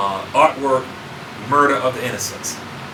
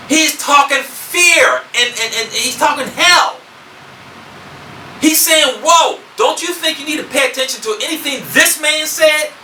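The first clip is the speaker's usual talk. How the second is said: high, emphatic preacher's voice; forceful preaching; 9 semitones higher than usual. Real, not acted. Preacher tells congregation he is talking hell, forceful preaching. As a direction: preach it hard, driving each point.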